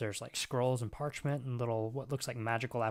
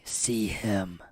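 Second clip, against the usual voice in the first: demon voice; 2 semitones lower, 4 dB louder than usual. Acted. Demon whispers to the player.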